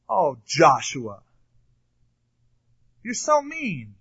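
hammed-up mock dismay; mock reproach. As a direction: play a scandalized objector, pitch leaping up. Voicing an imagined listener's mock objection.

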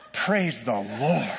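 slow, breathless relief; mild mock relief; relieved sigh. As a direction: exhale it at the cliff edge. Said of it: "the guy's sweating bullets".

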